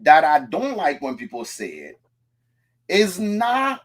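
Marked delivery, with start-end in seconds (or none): none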